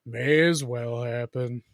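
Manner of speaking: slow, low, mopey donkey; Eeyore voice. Retold or imitated. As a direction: drag it out like gloomy Eeyore. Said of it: "That would be Eeyore".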